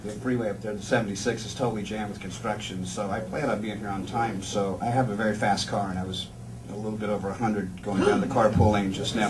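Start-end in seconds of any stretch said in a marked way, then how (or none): none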